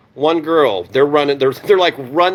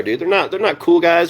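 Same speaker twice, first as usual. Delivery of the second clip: ordinary talk, nothing marked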